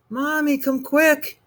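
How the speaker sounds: put-on high, slow child's cry; a scared boy calling his mother; frightened cry. A narrator's reading. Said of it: "he cried".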